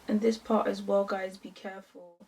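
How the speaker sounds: ordinary talk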